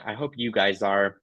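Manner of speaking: ordinary talk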